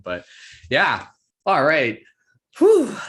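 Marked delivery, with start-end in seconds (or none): 2.6-3.0 big exhale after a long set; relieved whew. Real, not acted. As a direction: blow out a loud breath. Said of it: "just to be able to catch my breath".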